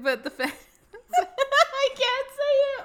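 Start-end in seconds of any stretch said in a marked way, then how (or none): 0.5-2.8 high, loud, laughing too hard to talk; real helpless laughter. Real, not acted. Podcaster fails at a German word, laughing helplessly. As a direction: squeal it out through laughter. Cracking up over failed pronunciation.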